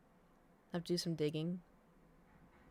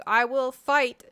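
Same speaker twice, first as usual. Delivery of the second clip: mock battle cry; 6 semitones higher, 8 dB louder, much slower than usual. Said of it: "all very goofy".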